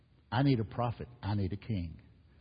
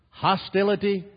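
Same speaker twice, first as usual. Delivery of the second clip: much higher and louder; preached cry; 9 semitones higher, 8 dB louder than usual. Acted. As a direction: ring out the first word. Preacher crying out a contrast.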